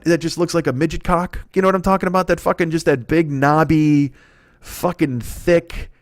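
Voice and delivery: ordinary delivery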